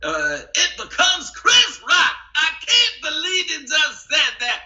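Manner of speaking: high-pitched Chris Rock rant. Retold or imitated. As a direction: crank the voice up into a squealing Chris Rock. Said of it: "give it a little more power".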